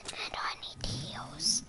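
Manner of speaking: low whisper to oneself. Real, not acted. Player to self about getting a chest, whispering. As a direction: whisper it under your breath.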